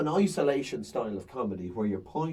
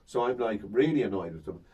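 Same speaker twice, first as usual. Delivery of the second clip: normal talking voice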